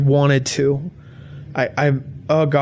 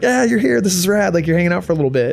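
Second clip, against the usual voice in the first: high, bright-eyed greeting from thrilled high school friends; excited friends; 4 semitones higher, faster than usual. Retold or imitated. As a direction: pitch way up like old buddies cheering his return.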